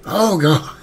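high, loud "Oh, God."; loud startled exclamation; alarmed cry. Real, not acted. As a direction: blurt it out in alarm. Alarmed at game event.

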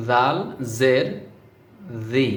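জাল জের জি with the tongue tip on the upper teeth; careful pronunciation. Shown as a demonstration. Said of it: "এভাবে পড়তে হইতো".

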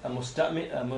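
normal talking voice

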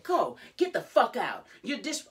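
high, loud re-enacted order; angry shouting. Retelling Jade throwing her mother out, angry shout.

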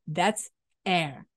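usual speaking voice

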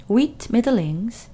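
usual speaking voice

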